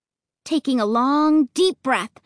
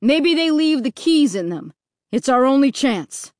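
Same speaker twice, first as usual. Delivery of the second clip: mildly raised, urgent call; 4 dB louder than usual. A narrator's reading. Dad calls back to the family, leading the way. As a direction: call back to those following. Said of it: "Dad called".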